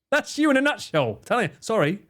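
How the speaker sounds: ordinary delivery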